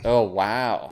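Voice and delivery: ordinary delivery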